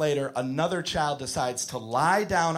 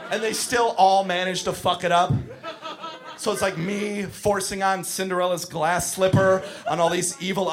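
ordinary talk, nothing marked

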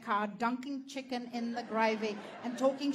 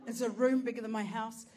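ordinary talk, nothing marked

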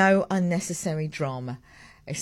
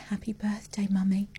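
put-on softer, whispered little-girl greeting; imitated whisper; 3 semitones higher, 5 dB quieter than usual. Mother imitating daughter's whispered morning greeting. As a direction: breathe it like a sleepy child.

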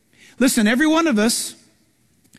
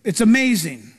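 usual speaking voice

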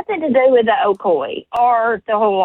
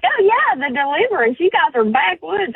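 higher-pitched mimicking of outsiders; mock outsider; 7 semitones higher than usual. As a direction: mimic people's teasing assumption, pitched up. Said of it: "people are like".

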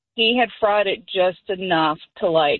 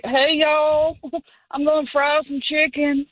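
pitched up, a tipsy big brother announcing a plan; higher, loose imitation of a drunk sibling; 6 semitones higher than usual. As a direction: raise the pitch and play the cheerful drunk older brother. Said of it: "That's, that's my drunk brother voice".

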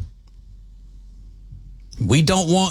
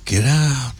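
horror movie voice. Pretend. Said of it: "It's like a horror movie".

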